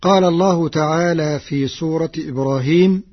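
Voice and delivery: ordinary talk, nothing marked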